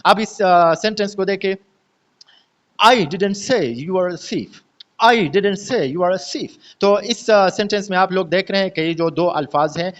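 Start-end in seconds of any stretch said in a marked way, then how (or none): 2.9-4.5 slow, with I strongest and say and thief a little prominent; stress on I, SAY, THIEF. Shown as a demonstration. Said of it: "थोड़े से prominent". 5.0-6.5 slow, with I strongest and say and thief a little prominent; stress on I, SAY, THIEF. Shown as a demonstration. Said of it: "थोड़े से prominent".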